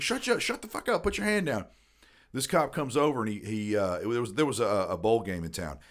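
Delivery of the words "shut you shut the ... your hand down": much higher, retold hissing protest; imitating his drunk younger self, flustered; high-pitched mock outrage; mock panicked drunk. Telling the bum to shut up.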